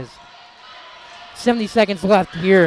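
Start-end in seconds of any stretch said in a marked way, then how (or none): 1.4-2.7 raised, urgent basketball call; lively sports commentary; live play-by-play. Real, not acted. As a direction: call the closing seconds with rising energy.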